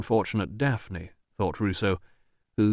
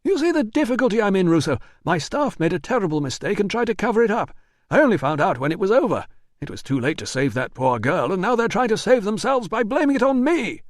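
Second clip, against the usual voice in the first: high, fast, defensive denial; nervous manager; 10 semitones higher, 4 dB louder than usual. Acted. Manager denies the letter to Russo, high and rushed. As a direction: talk fast to dodge the blame.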